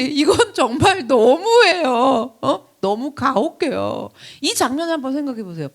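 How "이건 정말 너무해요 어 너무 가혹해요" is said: slow, half-laughing, half-crying protest; tearful complaint. Retold or imitated. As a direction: whine it like it's unfair, slowly. Believer complaining to God. (억울한 듯 울먹이며 천천히)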